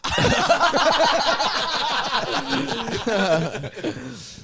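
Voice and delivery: high, drawn-out cackling laugh; real high-pitched laughing fit; long laugh. Real, not acted. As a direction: crack up at your own joke. Laughs at own boast.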